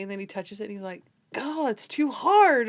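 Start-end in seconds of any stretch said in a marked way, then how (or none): 1.4-2.7 loud, high, drawn-out complaint; hammed-up cry of giving up; mock overwhelmed. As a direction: wail it slowly as if defeated. Acting a reluctant troll-rubber recoiling.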